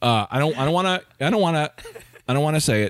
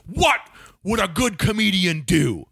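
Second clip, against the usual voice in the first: raised pitch, drawn-out heckle; threatening heckler; 4 semitones higher, slower than usual. Retold or imitated. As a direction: interrupt like a big menacing heckler, slowly. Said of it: "threatening thing. He was like, he was a big guy".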